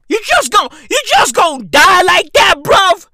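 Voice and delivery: loud disbelieving shout; loud comic outrage; outraged yell. Acted. Yelling at dead Queen.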